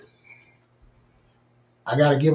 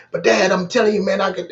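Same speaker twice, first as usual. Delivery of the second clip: higher, louder child insisting, lightly voiced; pleading kid; 6 semitones higher, 4 dB louder than usual. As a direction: son begging dad to let him be king. Son insisting he can be king.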